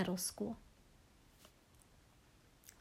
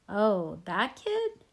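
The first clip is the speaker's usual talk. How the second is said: wide-eyed surprise; 4 dB louder, much slower than usual. A narrator's reading. Little brother remembers that kid, wide-eyed surprise. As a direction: voice leaps up in startled recognition. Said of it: "his eyes opening wide".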